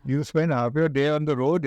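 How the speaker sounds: ordinary talk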